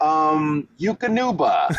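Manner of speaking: high announcer read; commercial read. Retold or imitated. First audition read.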